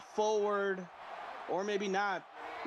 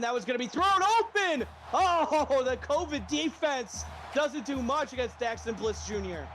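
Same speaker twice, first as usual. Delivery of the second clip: excited call; 5 semitones higher than usual. Real, not acted. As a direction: shout the big play.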